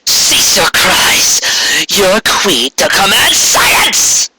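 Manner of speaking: a queen's slow, ringing decree; commanding shout. Acted. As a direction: order the crowd to silence, word by word. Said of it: "she shouted".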